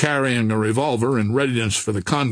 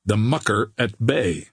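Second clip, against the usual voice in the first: ordinary delivery, nothing marked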